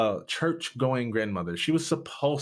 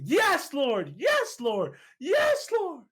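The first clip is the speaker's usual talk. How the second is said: screaming praise; much slower than usual. Retold or imitated. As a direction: cry out in ecstatic joy. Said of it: "I just started screaming".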